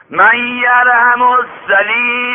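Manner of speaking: slow, drawn-out high wailing call; beggar crying out. Acted. Beggar outside the garden begging for mercy, wailing call. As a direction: call out for mercy, stretched and loud.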